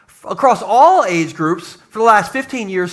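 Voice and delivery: normal talking voice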